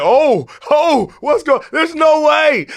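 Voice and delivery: high, loud shouted disbelief; hammed-up churchgoer shouting in disbelief; mock amazed congregation. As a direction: gasp out in shouted wonder like the church crowd. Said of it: "we're all like".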